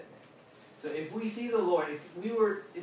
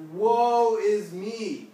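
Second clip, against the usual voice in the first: loud, drawn-out, raised-pitch wail; wail of doom; 7 semitones higher, 12 dB louder, much slower than usual. Acted. Isaiah fallen down before the Lord, acted wail. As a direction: stretch it out slowly in acted despair. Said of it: "he fell down and he was like".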